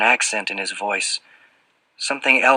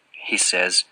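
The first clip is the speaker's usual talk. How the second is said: ordinary delivery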